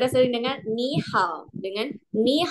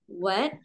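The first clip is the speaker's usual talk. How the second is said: What rising like a question; second tone; 4 dB quieter than usual. Shown as a demonstration. Reminding students of the tone, second tone. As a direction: lift the pitch through What. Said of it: "berubah menjadi nada dua".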